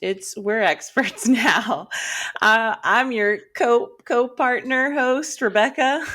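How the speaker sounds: slow, hammed-up Western drawl; mock cowboy accent, drawn out; cowgirl twang. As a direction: play a folksy ranch host welcoming folks. Podcast intro as cowgirls.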